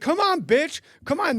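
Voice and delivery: very high, loud challenge; gone nuts. Retold or imitated. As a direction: re-enact goading him into a fight. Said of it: "I'm just nuts".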